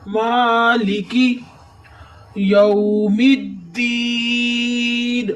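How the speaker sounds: یوم الدین with a long ee in الدین, slow; held deen. Shown as a demonstration. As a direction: pause and hold the ee of الدین. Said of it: "دین پہ اس لیے رکے". (الدین پر ٹھہراؤ)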